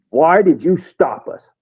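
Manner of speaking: slow, drawn-out retold demand; mildly indignant, stretching the question; fired up. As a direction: imitate a fired-up soldier's challenge. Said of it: "he is fired up".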